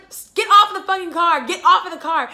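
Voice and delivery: loud, high order to get off; retold angry yell. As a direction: yell at an intruder, retelling it. Retold yelling at man on car.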